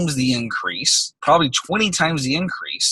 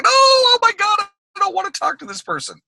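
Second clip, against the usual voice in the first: high-pitched wail dropping into complaint; panicked dread. Acted. Speaker mimics someone dreading a client's phone call, hammed-up wail.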